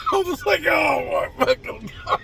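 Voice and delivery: high squeal through laughter; laughing mortification. Retold or imitated. Mechanic caught faking the call, laughing high-pitched mock dismay. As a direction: crack up while reliving the embarrassment. Laughing at his own embarrassment.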